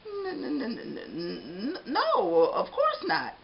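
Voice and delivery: stuttering n-n-no; flustered stammer. Acted. Caught man stammering denial.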